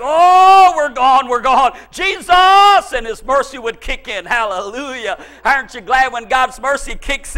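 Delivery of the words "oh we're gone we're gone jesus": retold panic, loud with high first and last bursts; panicked cry. Preacher re-enacting a bus about to crash, panicked cry. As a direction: holler as if the bus is going over.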